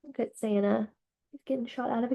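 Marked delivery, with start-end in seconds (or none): none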